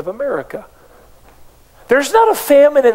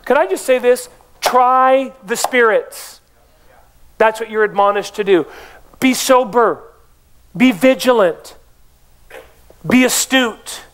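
preaching; 3 semitones higher than usual. Acted. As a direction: drive each command home, spaced out. Preaching at full force.

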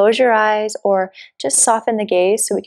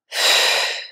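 long, forceful breath out through pursed lips; demonstrated mouth exhale. Shown as a demonstration. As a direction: blow the air out steadily through the mouth. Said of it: "exhale out through the mouth with pursed lips".

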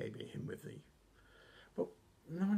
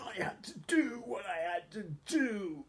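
high, strained wrestler promo voice; badly faked, intense wrestler impression; Macho Man Randy Savage; 10 semitones higher than usual. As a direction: push it up high, stretching the key words. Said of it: "I really can't do his voice".

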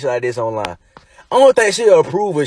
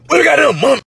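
high, loud outraged shout; shouting what the goddamn month; 11 semitones higher, 4 dB louder than usual. Real, not acted. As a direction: yell it in disbelief. Outraged exclamation.

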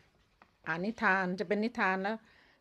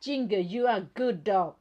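very slow, loud, each word spelled out; loud and slow, like reading to a toy; slow clear English; 3 semitones higher, 7 dB louder, much slower than usual. Shown as a demonstration. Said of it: "ต้องพูด ภาษาให้ชัดนะคะ".

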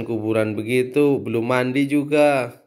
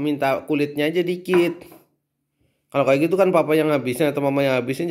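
usual speaking voice

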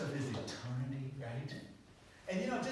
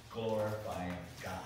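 ordinary delivery

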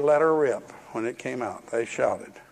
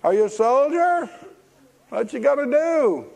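high, loud jeering taunts from the wall; a townsman yelling insults down at silent soldiers; mocking Jericho onlookers; 11 semitones higher, 3 dB louder than usual. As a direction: heckle the marchers from above. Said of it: "They said".

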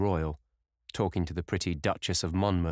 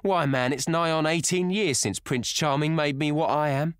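sneering; 9 semitones higher, 5 dB louder than usual. A narrator's reading. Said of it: "she sneered".

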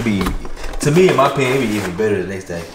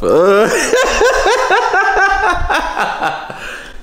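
high shrieking laugh. Real, not acted. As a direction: burst out in a shrill laugh. Cracking up at husband.

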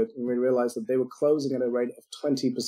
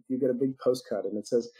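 usual speaking voice

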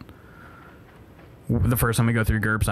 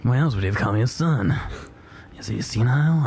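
Snake voice. Pretend. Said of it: "I'm talking like Snake".

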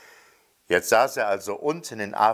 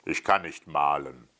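low grumbling pilot; growled reply; 6 semitones lower than usual. A narrator's reading. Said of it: "knurrte der Flieger". (tiefes, brummiges Knurren)

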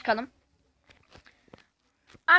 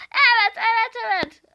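excited; 8 semitones higher than usual. Real, not acted. Heyecanlı evet.

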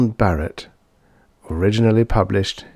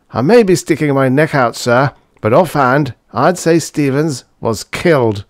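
high, louder doctor character voice; doctor venturing an opinion; 6 semitones higher, 5 dB louder than usual. A narrator's reading. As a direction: offer a diagnosis to a superior in a raised pitch.

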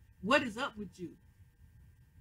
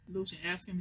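ordinary talk

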